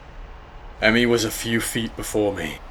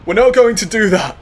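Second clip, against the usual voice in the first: urgent promise; 13 semitones higher, 10 dB louder, faster than usual. Acted. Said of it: "I promised".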